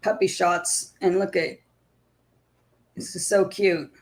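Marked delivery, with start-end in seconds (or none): none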